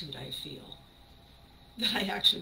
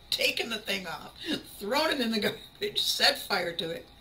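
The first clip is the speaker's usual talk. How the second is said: usual speaking voice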